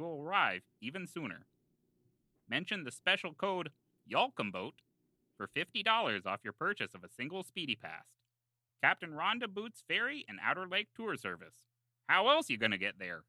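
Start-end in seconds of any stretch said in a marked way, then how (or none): none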